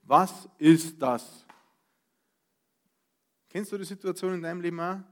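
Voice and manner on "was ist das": "Was ist das?" drawn out slowly and loud, each word weighted; loud slow emphatic question. Shown as a demonstration. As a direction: spell out the meaning of Manna with force. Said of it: "Manna heißt nichts anderes wie".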